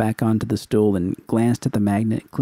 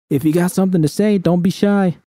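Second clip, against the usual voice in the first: loud, much higher taunting call; Judy yells a dare toward the bedroom, higher and louder; 9 semitones higher, 4 dB louder than usual. A narrator's reading. Judy taunts V across the apartment, loud call. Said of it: "I called out".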